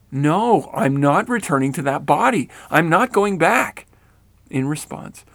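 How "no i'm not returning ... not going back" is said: loud, rising defiance; loud refusal. A narrator's reading. Rennell defying Grandma's order, loud refusal. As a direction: dig in and refuse.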